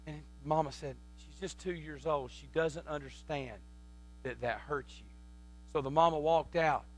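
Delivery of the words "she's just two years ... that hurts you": low, very soft, patient explaining; soothing mother. Retold or imitated. Mama to her hurt little boy, soft and soothing. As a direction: explain it tenderly, hushed and low.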